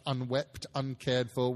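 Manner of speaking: usual speaking voice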